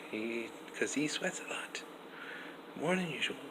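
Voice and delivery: a quick unvoiced aside; whispered aside. Real, not acted. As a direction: whisper it under your breath. Whispered aside about sweating.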